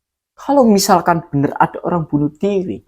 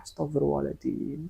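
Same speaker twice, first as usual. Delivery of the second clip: muttered istighfar; 16 dB quieter than usual. Retold or imitated. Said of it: "menguatkan dirinya dengan beristighfar".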